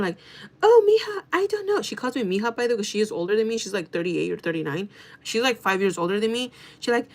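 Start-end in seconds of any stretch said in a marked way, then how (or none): 0.6-1.8 mocking, very high-pitched coworker; coworker's put-on voice. Older coworker excusing herself to mija, put-on voice. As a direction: mimic her fake voice, pitched way up. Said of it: "that's the voice she makes".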